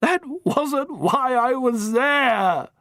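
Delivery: high, slow, pleading protest; desperate young man. Acted. Harry protests to the matron, desperate. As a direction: beg her to believe you. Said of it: "Harry declared desperately".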